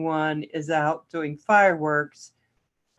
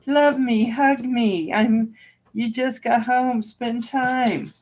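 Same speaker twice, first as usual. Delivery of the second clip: higher pleading dog voice; voicing the dog; 6 semitones higher, slower than usual. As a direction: beg like a needy dog, in a light, higher voice. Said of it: "she's just like".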